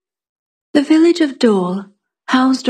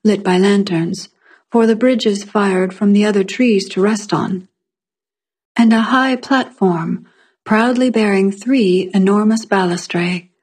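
usual speaking voice

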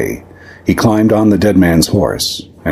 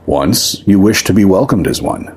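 plain reading voice

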